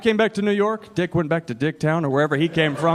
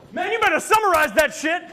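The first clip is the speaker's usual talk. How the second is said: high, yelled stranger's heckle; retold street yell; 10 semitones higher than usual. Stranger yelling at the sign man, high shout. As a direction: holler it across the street. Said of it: "this is what I hear yelled at him".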